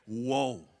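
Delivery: "woe" as the Bible word of grief, a long low "woh". Shown as a demonstration. Preacher teaching the congregation the word, a low drawn-out "woe". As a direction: stretch out "woe" low and heavy, not the surprised "whoa". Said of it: "It's woe, W O E".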